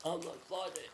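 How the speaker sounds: hushed, pleading call; soft acted cry. Jesus on the cross calling his Father, hushed cry. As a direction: call out weakly, barely voiced. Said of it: "and he cried".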